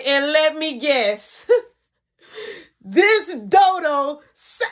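hammed-up exasperation; exasperated mother. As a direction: drag it out like a mom at her limit. Exasperated mother acted in story.

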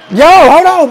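high loud yell of alarm; surprised shout. Real, not acted. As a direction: blurt it out in shock. First take shouted.

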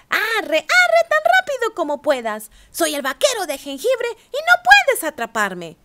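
high, loud taunting sing-song; teasing cookie voice. A narrator's reading. Gingerbread cowboy taunting the ranchers, high loud sing-song. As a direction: taunt them, high and cocky. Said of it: "se rió y dijo".

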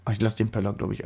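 ordinary delivery

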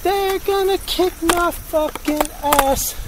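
high, slow, whiny mock panic. Driver joking that the passersby will beat him, in a slow high whine. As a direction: wail it like a scared kid, slowly. Repeats line.

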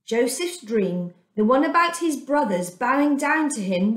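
ordinary delivery, nothing marked

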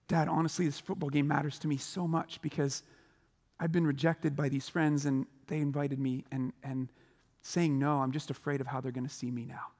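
soft, hushed and sincere; vulnerable honesty. Pretend. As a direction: confess it quietly to dad. Teen opening up to dad.

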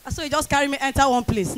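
ordinary talk

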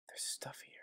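voiceless hushed aside; whispered discovery. Real, not acted. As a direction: murmur it under the breath. Quietly noting items.

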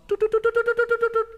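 rapid high toot-toot noise; comic tooting. A narrator's reading. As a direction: toot away like a tiny high horn.

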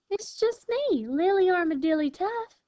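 very high, sing-out little armadillo voice; smallest sister. A narrator's reading. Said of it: "the smallest sister replied".

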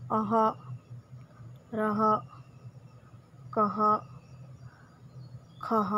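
"अह", "रह", "कह", "खह" each ending in a breathy h; syllable reading. Shown as a demonstration. Teacher shows children the ah sound, clear and spaced. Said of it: "उसको हम क्या read करेंगे".

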